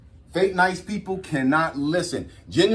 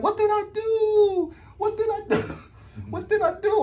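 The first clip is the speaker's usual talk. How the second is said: high, pleading mock-hurt girl's voice; imitating sad girl. Ghosted girl asking the man what she did wrong, mock sad. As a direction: whine it like she's lost and hurt. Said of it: "she came up to me all sad".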